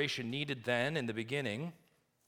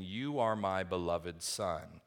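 solemn divine voice; 4 semitones lower, slower than usual. Acted. Quotes the heavenly voice slowly.

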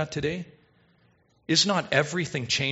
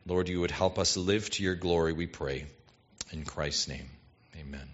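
low, hushed congregational prayer; 8 semitones lower, 5 dB quieter than usual. Real, not acted. Pastor asking God to help the church receive the words, in low prayer. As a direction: pray softly, sinking lower and quieter to the amen.

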